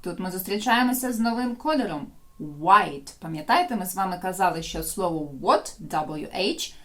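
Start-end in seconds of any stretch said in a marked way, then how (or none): none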